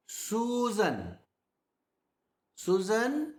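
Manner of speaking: "Susan" with both syllables drawn out; emphasized syllables. Shown as a demonstration. Teacher showing the difference it creates, "Susan" drawn out. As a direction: stretch "Susan" out fully, leaning on the vowels. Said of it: "it creates so much of a difference".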